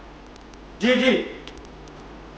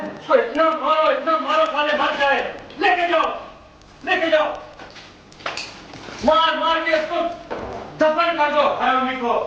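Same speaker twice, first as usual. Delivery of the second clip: raised, high-pitched furious commands; angry orders; 5 semitones higher than usual. Acted. Interrogator ordering his men to beat the prisoner, angry.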